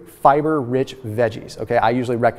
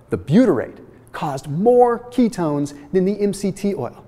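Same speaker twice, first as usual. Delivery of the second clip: slowly, with MORE punched high; slow emphatic repeat; 5 semitones higher than usual. Shown as a demonstration. Presenter repeating the study's surprising finding to viewers, slowly and emphatically. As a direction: say it again slowly, spacing out the phrases for emphasis. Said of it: "Let me say that again slowly".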